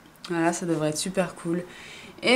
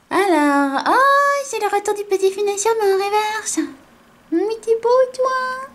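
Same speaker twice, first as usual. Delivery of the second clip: high cooing voice; 13 semitones higher than usual. Real, not acted. Cooing over a cute card.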